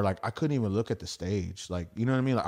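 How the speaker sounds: ordinary talk, nothing marked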